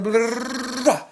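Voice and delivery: high, rapid babble of blahs; mind racing gibberish. Acted. The mind at a billion thoughts a second, frantic babble. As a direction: babble it out like thoughts spilling over. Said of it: "Billion thoughts a second".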